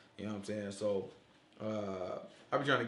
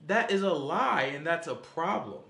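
usual speaking voice

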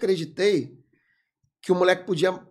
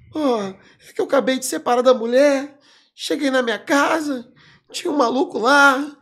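put-on 1 whiny kid; 6 semitones higher, 6 dB louder than usual. Voicing the crying kid.

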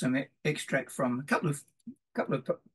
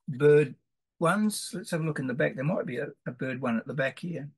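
ordinary talk, nothing marked

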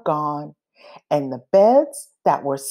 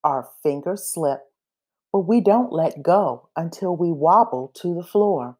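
ordinary delivery

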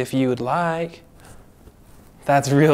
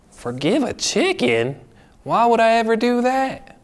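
incredulous; 7 semitones higher than usual. Acted. Told to forgive a chicken.

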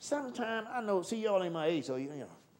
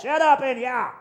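usual speaking voice

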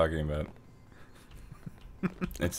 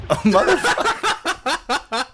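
laughing curse; 11 semitones higher, 3 dB louder, slower than usual. Real, not acted. Game going wrong.

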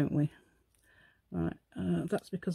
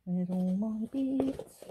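drawn out, pitched up and gleeful; slow sing-song gloat; 3 semitones higher, much slower than usual. Acted. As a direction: savour each word slowly. Repeats line in a new voice.

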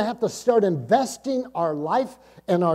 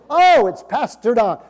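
raised, louder exclamation of recognition; acted glad recognition; 3 semitones higher, 8 dB louder than usual. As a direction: greet a familiar visitor, acted out, louder and higher. Acting out their delighted recognition.

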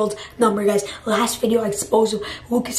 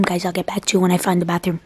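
usual speaking voice

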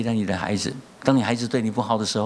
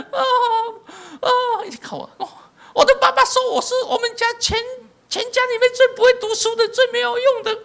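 much higher, louder mock sobbing voice; imitated wailing; 5 dB louder than usual. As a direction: wail it out high like a crying woman. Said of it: "伊从莫钟杰大声哭".

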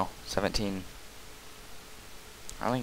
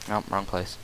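normal talking voice

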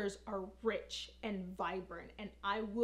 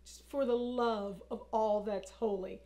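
ordinary talk, nothing marked

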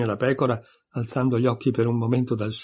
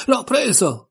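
much higher, louder triumphant shout; triumphant cry; 11 semitones higher, 4 dB louder, slower than usual. A narrator's reading. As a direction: crow it out like you just caught it. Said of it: "gridò in tono trionfante".

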